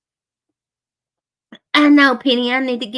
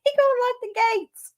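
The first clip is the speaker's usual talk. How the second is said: laughing mockery; 10 semitones higher, faster than usual. Mocking someone, laughing.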